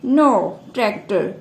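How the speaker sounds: usual speaking voice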